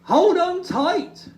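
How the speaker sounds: high, loud and slow warning call. Acted. Hoot warns Little Bear as he leans over, calling out. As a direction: call out in alarm. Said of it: "called Hoot".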